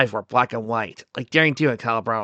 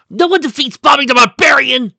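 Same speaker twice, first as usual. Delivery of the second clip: very high, loud victory cry; triumphant shout; 11 dB louder than usual. Acted. Bobby boasting with club raised high, triumphant shout. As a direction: roar it like a victorious little barbarian.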